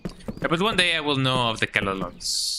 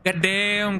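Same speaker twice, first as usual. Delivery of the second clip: startled exclamation; 8 semitones higher than usual. Real, not acted. Startled by jump scare.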